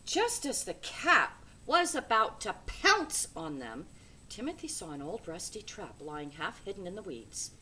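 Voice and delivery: ordinary delivery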